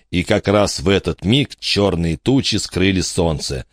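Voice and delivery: ordinary delivery, nothing marked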